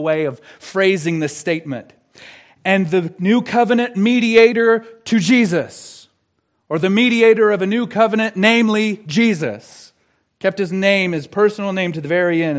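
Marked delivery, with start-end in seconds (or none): 2.6-9.9 "Jesus" held to the end for dramatic effect; raised, louder voice, landing firmly on "Jesus"; emphatic rephrasing. Shown as a demonstration. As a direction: build loudly towards the final "Jesus". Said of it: "for dramatic effect".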